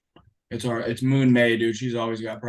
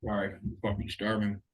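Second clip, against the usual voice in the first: eating while talking; 2 semitones lower, 6 dB quieter than usual. Real, not acted. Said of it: "Are you eating ice cream?".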